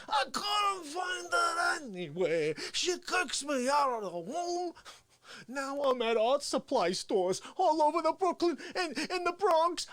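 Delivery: high-pitched manic Fauci persona, mock outrage; Fauci character voice. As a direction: play the unhinged rival host. Streamer voicing Fauci character.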